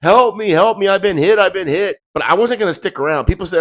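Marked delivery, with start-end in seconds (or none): 0.0-2.0 loud, high-pitched cries for help; imitated screaming. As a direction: yell it like the wounded passenger. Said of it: "his yelling and screaming".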